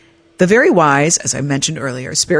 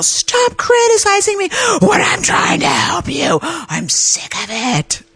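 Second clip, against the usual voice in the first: replayed meltdown yell, pitched way up; furious yell; 13 semitones higher, slower than usual. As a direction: scream it at a critic, arms flailing. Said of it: "I flail my arms and yell".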